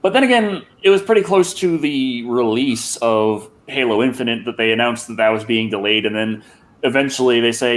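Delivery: usual speaking voice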